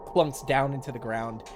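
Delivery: ordinary talk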